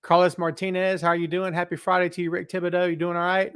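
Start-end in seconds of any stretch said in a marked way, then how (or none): none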